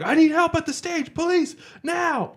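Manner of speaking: high urgent call for help; urgent yell. Retold or imitated. Sound guy summoning officers, urgent high yell. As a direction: demand police now. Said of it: "started yelling".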